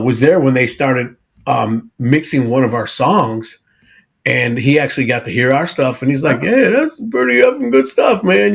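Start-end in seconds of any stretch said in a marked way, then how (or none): 6.4-8.5 high-pitched rock star impersonation. Retold or imitated. Bandmate retells a rock star praising their songs, high imitation voice. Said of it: "however [name] talks".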